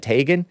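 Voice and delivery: "Teigen" as TAY-gen; long a in "Teigen"; preferred pronunciation. Shown as a demonstration. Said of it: "I wanted to call it Teigen".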